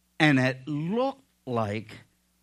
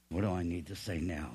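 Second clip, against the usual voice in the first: quick inner thought; 9 semitones lower, 10 dB quieter, faster than usual. Acted. Inner thought while arguing.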